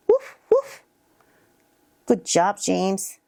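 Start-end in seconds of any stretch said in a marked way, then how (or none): 0.1-0.8 very high-pitched woofs; small squeaky play bark; dog barking. Acted. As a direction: woof like a little kid playing dog. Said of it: "Dog goes".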